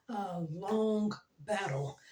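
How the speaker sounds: normal talking voice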